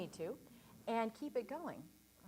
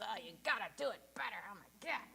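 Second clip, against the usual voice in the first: self-critical inner voice; 5 dB louder than usual. Retold or imitated. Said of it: "hate ourselves or beat ourselves".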